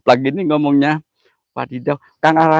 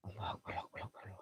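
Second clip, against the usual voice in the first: frightened whisper; 8 semitones lower, 30 dB quieter than usual. Real, not acted. Scared during a ghost ritual.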